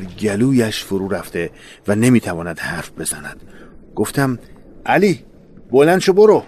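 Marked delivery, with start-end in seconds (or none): 4.9-6.4 high, loud urging to get up and go; urgent shout. Retold or imitated. To wounded Ali in the trench, a loud urgent plea. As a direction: push him to run, voice raised.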